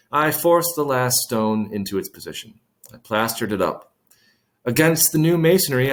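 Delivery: ordinary delivery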